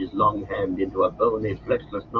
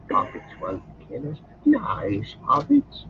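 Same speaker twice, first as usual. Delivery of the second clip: high, hissing creature voice, pleading; Gollum's voice; 4 semitones higher, slower than usual. Acted. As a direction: wheedle like a wretched creature. Gollum wheedles the hobbits.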